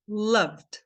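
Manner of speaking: "Loved" as one syllable, E silent; teacher's model "Loved", O as in "uh"; one-syllable pronunciation. Shown as a demonstration. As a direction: say "Loved" as luhvd, no second vowel. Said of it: "Olha como que eu vou falar essa palavra".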